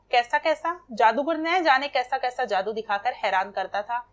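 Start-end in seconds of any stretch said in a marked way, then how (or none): none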